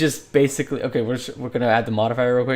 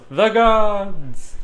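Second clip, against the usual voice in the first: high, louder mock yell; crowd shout; 7 semitones higher, 5 dB louder than usual. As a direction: shout it like the whole crowd agrees. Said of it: "everyone just yells".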